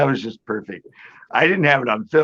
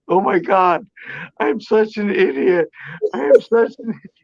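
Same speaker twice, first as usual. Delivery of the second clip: embarrassed disbelief; 8 semitones higher than usual. Retold or imitated. Coworker realizes owl is fake.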